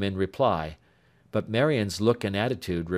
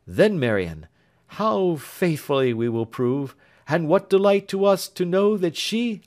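put-on raised, tender young lover's voice; lightly voiced earnest young man; 7 semitones higher, 4 dB louder than usual. Young man tells Marian of Grace, tender and higher. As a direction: speak fondly of the absent sister.

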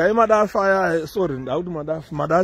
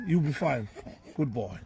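soft, lowered comforting voice; tender reassurance; 4 semitones lower, 10 dB quieter than usual. Real, not acted. Telling a hurt boy he is fine, tender.

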